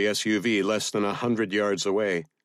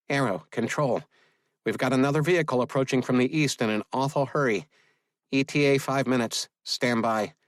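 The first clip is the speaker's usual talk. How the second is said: brisk, higher radio dispatch voice; businesslike controller, pitched up; 5 semitones higher than usual. A narrator's reading. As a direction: relay the sighting urgently over comms.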